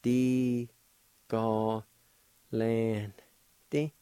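"Di, ga, lan, di" in long separate syllables, lan nasalized; syllable-by-syllable demo. Shown as a demonstration. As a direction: sound out "Di, ga, lan, di" with a gap after each. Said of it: "like this".

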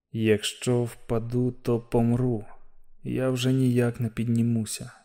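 quiet dread inside his head; exhausted thought. Acted. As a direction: afraid of falling.

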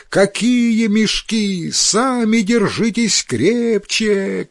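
high, stretched-out cry; sailors shouting back. A narrator's reading. Other sailors answer crewmates in the storm, shouting back. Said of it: "кричат другие".

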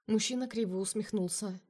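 ordinary delivery, nothing marked

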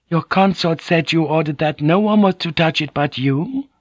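high-pitched, lightly voiced Japanese lady; courteous woman interpreter. A narrator's reading. Mariko interprets a question.

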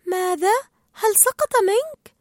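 high, guileless asking; innocent question. A narrator's reading. Dory asks Marlin about the mask, high and innocent. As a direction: ask naively, pitch lifting high. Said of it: "سألته دوري ببراءة".

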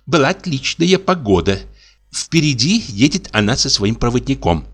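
plain reading voice

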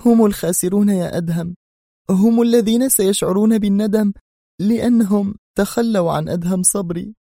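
high, impassioned female voice; bitter, tearful protest. A narrator's reading. She defends Adham through tears, bitter and high-pitched. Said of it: "وهي تغمغم في مرارة".

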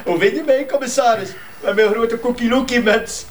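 high, giggling show-off; laughing. Real, not acted. Officer showing the commissaris his silly hat, laughing high.

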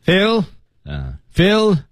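"Phil" called out high and falling; calling a name. Shown as a demonstration. Teacher showing how to call someone, calling out. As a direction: call "Phil" across the room. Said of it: "叫某一个人的时候".

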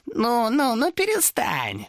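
high, slow mock comfort; mock soothing demon. A narrator's reading. Demon mock-soothes sobbing Thomas. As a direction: pat him on the head with your voice. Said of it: "сказал демон".

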